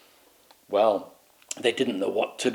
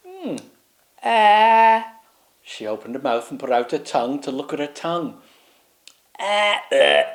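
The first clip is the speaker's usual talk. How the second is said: puzzled grunts; 3 semitones higher than usual. Acted. Queen examining her face.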